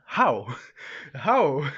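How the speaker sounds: two loud, separate questions of disbelief; mock surprise. Jin reacting to Izu crying, surprised. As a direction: imitate a baffled character, punching each how. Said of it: "Jin is surprised".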